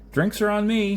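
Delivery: loud, high call to the crowd; shouting to the crowd that the drinks are on him. Acted. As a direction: throw it out over the whole casino. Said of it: "he shouted".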